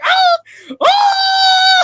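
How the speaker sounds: high, loud panicked scream; screaming brother. Retold or imitated. Brother in the spinning car, shrieking in terror. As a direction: shriek like the car's about to flip. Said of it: "my brother was like".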